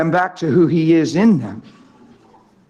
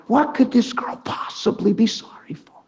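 higher, puzzled retold thought; perplexed; 4 semitones higher, 4 dB quieter than usual. Husband wondering at his wife's apology, perplexed. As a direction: act out baffled wondering. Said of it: "And I was perplexed".

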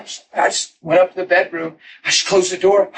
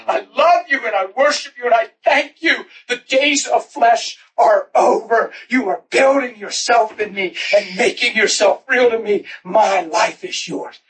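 higher, fervent worship prayer; 6 semitones higher than usual. Retold or imitated. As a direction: pour out praise with rising fervor. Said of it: "I would pray that way".

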